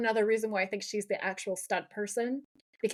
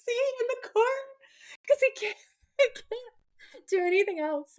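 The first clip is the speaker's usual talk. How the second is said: high-pitched talk through real laughter; really cracking up, barely getting it out; dying laughing; 11 semitones higher than usual. Real, not acted. Co-host describing Dean obeying helplessly, dying laughing. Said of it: "I'm dying laughing".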